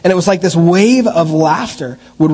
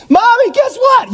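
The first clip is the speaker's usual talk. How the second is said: put-on excited kid. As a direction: burst out like a kid who can't hold it in. Kid blurting out a secret.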